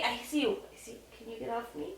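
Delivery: usual speaking voice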